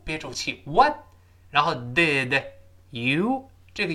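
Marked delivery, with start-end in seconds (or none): 0.7-1.0 "what" with breath held on the t; "what" rising into a silent stop; unreleased final t. Shown as a demonstration. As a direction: plant the tongue and hold the t of "what". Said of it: "舌尖顶住，憋住气".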